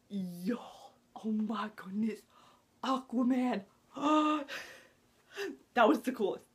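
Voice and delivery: gasping breathy whisper of awe; hushed yo, oh my goodness; awed whisper. Real, not acted. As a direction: whisper like a fan seeing a secret reveal. Awe at Aquaman file.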